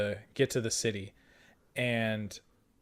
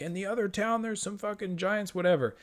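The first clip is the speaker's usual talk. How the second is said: higher-pitched, softer messenger report; 8 semitones higher, 4 dB quieter than usual. Retold or imitated. Bloody messenger on horse warning the party, higher. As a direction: toss off the news in a light higher voice. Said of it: "he's like".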